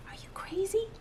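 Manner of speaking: whispered, high and hushed disbelief; incredulous whisper. Acted. Farmer to friend in dark house, hushed whisper. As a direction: hiss it so the children sleep.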